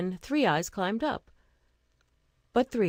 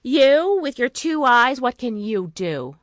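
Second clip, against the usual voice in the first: much louder, higher, jeering; scornful shout; 4 semitones higher, 9 dB louder than usual. A narrator's reading. Sisters jeering at Two Eyes, loud and mocking. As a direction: shout her down with contempt. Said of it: "cried her sisters".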